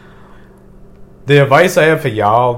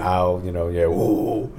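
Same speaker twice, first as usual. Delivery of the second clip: ordinary talk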